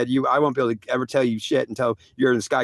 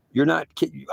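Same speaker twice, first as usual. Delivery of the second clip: usual speaking voice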